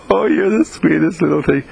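put-on high, doting baby-talk coo. Babysitter fussing over a gentle little baby, cooing. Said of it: "This little baby that is so gentle".

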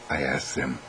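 plain reading voice

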